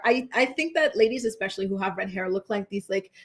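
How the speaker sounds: ordinary delivery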